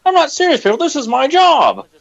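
high-pitched, exasperated movie-quote impression; Donald Sutherland. Burnt-out host quoting Animal House, Donald Sutherland impression. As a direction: plead it in a strained high voice, drawing out the end. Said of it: "I feel like Donald Sutherland in Animal House".

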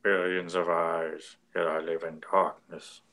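low, slow monster rumble; deep growling beast voice; Minotaur voice. Retold or imitated. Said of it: "I like the Minotaur's voice here".

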